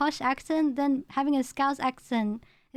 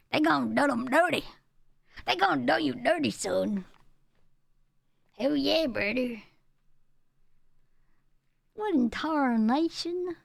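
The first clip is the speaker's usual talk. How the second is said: put-on Southern Mater accent. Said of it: "He has that accent".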